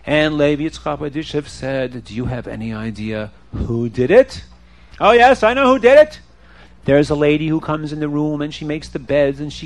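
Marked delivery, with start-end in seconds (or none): 2.0-4.4 low, measured rabbi voice; grave question from a rabbi; rabbi's questioning. Acted. 5.0-6.2 high, loud burst of certainty; eager accusation. Acted.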